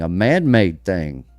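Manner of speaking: ordinary delivery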